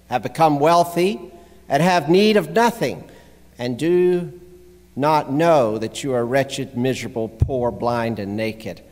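scripture reading. Real, not acted. Pastor reads the rebuke to Laodicea, emphatic scripture reading.

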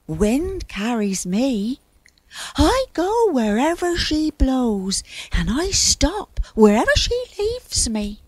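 high-pitched, helpless little character; plaintive cloud. A narrator's reading.

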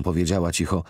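plain reading voice

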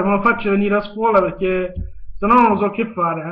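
ordinary delivery

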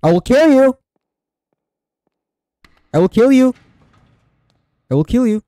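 high loud shrieking threat; loud shrieked threat; mock threat. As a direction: scream the threat to scare it off. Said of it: "I go".

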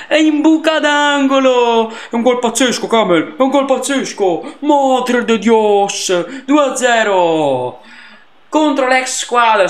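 high, loud ecstatic pundit yelling the goal. Acted. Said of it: "oggi sto in mood [name]".

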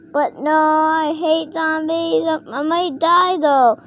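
high whiny pretend fear, rising to a wail; mock dread. Toy afraid it might die, high mock-scared whine. Toy fears dying to zombies.

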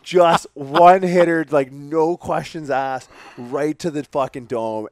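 big, slow, loud punchline; excited emphasis. Real, not acted. Telling friends about the knockout, drawn out loud and thrilled. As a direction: savor every word of the knockout.

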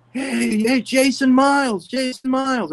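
high, slow street yell of a name; retold yelling. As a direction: call his name across the street, high and drawn out. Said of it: "I hear this guy yelling".

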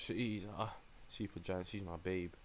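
ordinary talk, nothing marked

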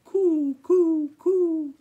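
high, loud drawn-out coos; hens cooing. A narrator's reading. Farmer listening at coop door hears high, loud cooing. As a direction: imitate a cooing bird, sustained and high. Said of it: "He heard soft cooing".

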